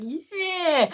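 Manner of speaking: very high, ecstatic "Yeah!"; joyful cheer. Retold or imitated. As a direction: shout it like you just won. Celebrating admission.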